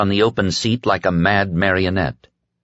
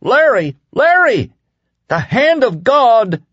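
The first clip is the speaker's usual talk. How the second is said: much higher, louder ecstatic shriek; joyful shriek at the sky; 5 dB louder than usual. A narrator's reading. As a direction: scream it out in terrible joy. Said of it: "suddenly Ralph shrieked".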